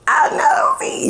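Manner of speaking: tiny high whisper, slow; mock-ashamed whisper "I'm nosy"; whispered confession. Real, not acted. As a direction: breathe it out like a guilty secret.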